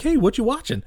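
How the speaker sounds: lightly put-on high, eager hello; chirpy retold greeting. Voices Jerry greeting his father-in-law.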